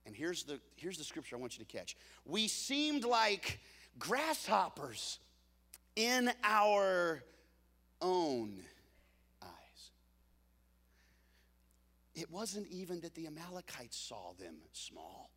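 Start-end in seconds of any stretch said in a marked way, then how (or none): 2.2-9.9 slow dramatic reading. Acted. Reading key scripture dramatically.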